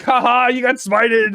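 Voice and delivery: hammed-up gloating; mock gloat. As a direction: rub it in like a playground taunt. Gloating at friend after podcast.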